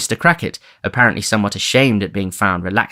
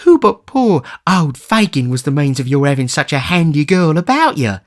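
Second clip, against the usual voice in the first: put-on much higher, pleading old man; 8 semitones higher, 4 dB louder than usual. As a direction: soothe him meekly.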